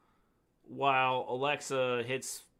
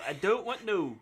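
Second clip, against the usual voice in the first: higher-pitched mock-accent "I don't want no"; botched accent imitation; 5 semitones higher, slower than usual. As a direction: mimic her accent badly, pitched up. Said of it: "You're not saying it right".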